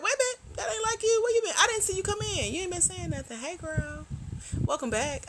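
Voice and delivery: high, squealing welcome-back greeting; high-pitched delighted hello to a regular viewer; excited squeal. Real, not acted. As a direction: gush at a friend you missed.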